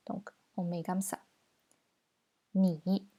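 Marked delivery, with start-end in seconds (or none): 2.5-3.1 "Ni" with a dipping third tone. Shown as a demonstration. Teacher shows where the tone mark goes, "Ni" dipping. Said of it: "C'est un troisième ton".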